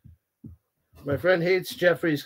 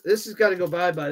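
ordinary talk

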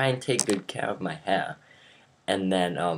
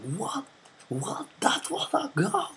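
very high squeaky protest; mock disbelief. Opposing team protesting the referee's call, mock squeaky disbelief. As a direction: squeal it like outraged sore losers. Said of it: "They were like".

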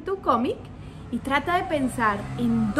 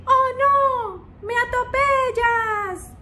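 high, slow cry of a panicked cat; cat screaming; 11 semitones higher, 4 dB louder, much slower than usual. Retold or imitated. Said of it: "el gatico grita". (el gatico grita agudo y lento)

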